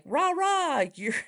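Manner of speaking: high, sarcastic cheerleader chant; mock cheer. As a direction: pretend to cheer her on, high. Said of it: "going like".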